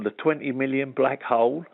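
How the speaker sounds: ordinary delivery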